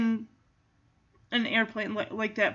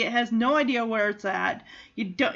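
usual speaking voice

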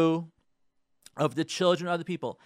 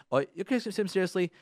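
usual speaking voice

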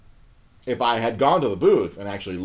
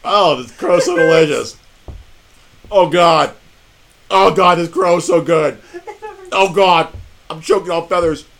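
loud, raised, mock-relish agony; hammed-up eating crow; 5 semitones higher, 12 dB louder than usual. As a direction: overact choking down a humiliating meal. Acts out eating crow before friend.

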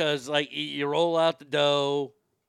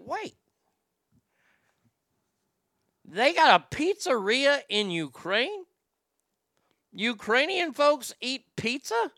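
much higher, slow, incredulous; mock astonishment; 8 semitones higher, slower than usual. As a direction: act floored by the idea of pizza there. Mock disbelief at Ukrainian pizza.

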